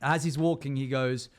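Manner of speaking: ordinary delivery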